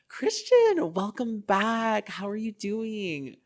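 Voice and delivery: high, loud sing-out welcome; mock gushing greeting. Receptionists at the counter hailing a returning patient, gushing mock welcome. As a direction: greet a regular in an exaggerated high voice, drawing it out.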